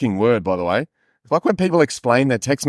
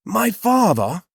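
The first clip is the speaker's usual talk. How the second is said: Jacob calling father; 8 semitones higher, slower than usual. Acted. Voices Jacob calling his father.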